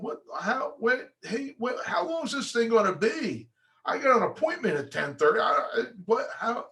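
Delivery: a mock-flustered client glancing at his watch; impatient buyer. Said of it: "the buyer's looking at his watch going".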